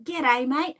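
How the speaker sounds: hammed-up Australian accent greeting. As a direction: chirp it like a cheeky Aussie kid. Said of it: "They'll be saying".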